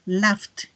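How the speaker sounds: "laughed" as one syllable ending in t; final T. Shown as a demonstration. Teacher drills past forms, "laughed" ending in a clear T. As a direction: add a released T to close "laughed". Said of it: "Al final suena como una T".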